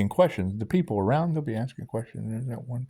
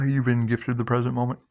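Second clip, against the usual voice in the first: normal talking voice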